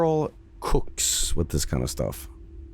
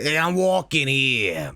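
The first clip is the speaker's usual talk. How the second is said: slow, lightly voiced New Yorker shout; New York accent; 3 semitones higher, slower than usual. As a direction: drawl it like a Brooklyn cabbie. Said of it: "Making Vinny say".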